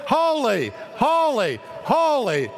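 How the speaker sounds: high ringing shouted Holy; seraphim calling. Acted. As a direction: call it across like a heavenly choir. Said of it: "they're singing back and forth".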